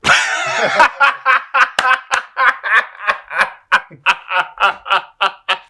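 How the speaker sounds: loud high laughter; long laugh. Real, not acted. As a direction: burst out laughing. Laughing at the scene.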